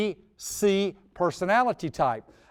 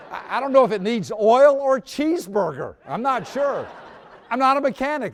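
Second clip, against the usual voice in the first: laughing through a mock clueless ramble; laughing helplessness; 5 semitones higher, 5 dB louder than usual. Speaker to mechanic, laughing clueless owner. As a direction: giggle out the cluelessness.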